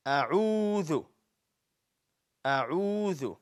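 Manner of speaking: "أعوذ" projected, with a long held "oo"; model pronunciation. Shown as a demonstration. Teacher showing how to say it, "أعوذ" stretched out. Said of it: "We're supposed to say it like".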